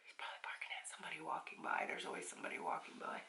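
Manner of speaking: fast, soft whisper to herself; hushed aside between calls to the dog. Real, not acted. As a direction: whisper it under your breath, quickly. Muttering aside while calling the dog.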